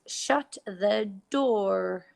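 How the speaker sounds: "Shut the door." said slowly, each word spaced out; slow sentence demo. Shown as a demonstration. Teacher modelling the sentence for pupils, slow and clear. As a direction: read it slowly and clearly for the class. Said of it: "the sentence we have is".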